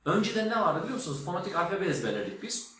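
ordinary talk, nothing marked

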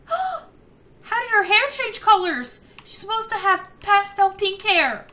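high, loud, drawn-out disbelief; shocked outrage. Real, not acted. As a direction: gasp and exclaim it in dismay. Shocked at sim's changed hair.

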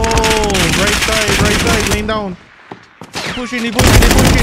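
loud urgent callout; shouting callout. Real, not acted. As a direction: yell the enemy position. Enemy spotted, urgent callout.

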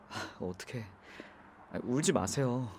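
gentle hushed reassurance; consoling. Acted. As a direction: comfort her so she stops crying. Consoling crying passenger.

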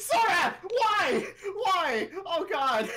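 high, loud cries of betrayal; dismayed wail. Real, not acted. Gamer left to play by Sora, loud wailing dismay. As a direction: wail at a teammate who deserted you.

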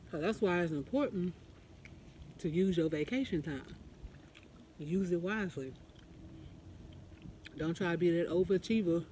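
chewing between words; talking while chewing. Real, not acted. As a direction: mouth partly full. Seen 2: Chip into mouth at -1; lips pursed, chewing shapes through 8.7.

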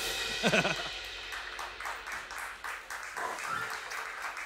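breathy laugh fading out. Real, not acted. Cohost laughing quietly after the toast.

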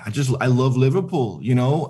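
normal talking voice

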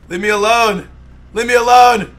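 loud, high protesting yell; protesting shout. Pretend. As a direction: yell to be left alone. Being dragged off.

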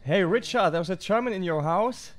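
loud playful call across the house. Pretend. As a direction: shout it teasingly to Richard. Teasing friend, calling out.

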